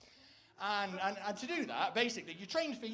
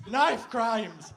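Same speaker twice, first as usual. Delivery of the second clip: "Knife crimes" repeated much louder, higher and alarmed; mock alarmed cry; 4 semitones higher, 12 dB louder than usual. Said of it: "Like that".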